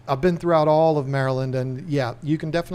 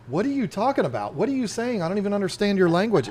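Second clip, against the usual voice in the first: higher-pitched, baffled questioning; mock bafflement; 6 semitones higher, faster than usual. Baffled at a coworker turned Rasta, mock incredulous. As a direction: act out being totally lost by someone's speech.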